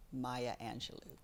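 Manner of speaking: normal talking voice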